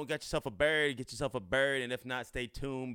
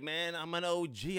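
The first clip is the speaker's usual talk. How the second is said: mock cool guy; 8 semitones higher than usual. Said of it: "You're like".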